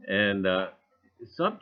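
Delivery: ordinary talk, nothing marked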